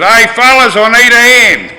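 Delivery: loud, high call for help through the door. Retold or imitated. Calling his mates out of the disco bar, loud and high. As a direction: yell into the bar for backup.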